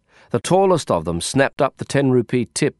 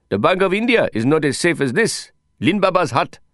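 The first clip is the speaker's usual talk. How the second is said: put-on excited Indian man, Indian accent; proud Indian accent, rising indignation; 7 semitones higher than usual. Johnny defends his hut.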